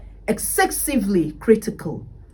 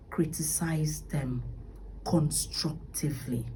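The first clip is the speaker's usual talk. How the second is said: emphatic whisper; 4 semitones lower, 10 dB quieter than usual. Real, not acted. Stressing the key advice softly.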